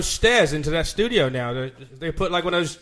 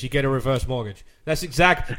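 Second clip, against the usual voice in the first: ordinary delivery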